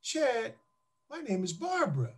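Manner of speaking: a hint of a polite lady caller; imitating Barbara. Player imitating Barbara on the phone, high and soft. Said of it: "he goes".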